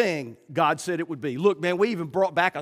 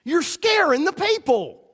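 higher, hushing rebuke; mock urgent shush, higher; 8 semitones higher than usual. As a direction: Joshua and Caleb shushing the spies. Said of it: "Joshua and Caleb might have said".

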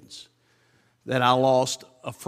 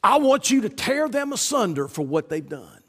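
a hint of raised, vengeful pleading; vengeful prayer; 8 semitones higher than usual. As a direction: pretend to demand revenge from God. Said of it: "he didn't say".

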